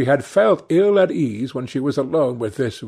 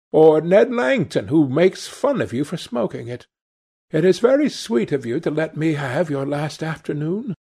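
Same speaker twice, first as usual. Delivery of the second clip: put-on high girlish voice, eager; affectionate sister; 5 semitones higher than usual. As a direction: chatter fondly to a brother in a higher voice.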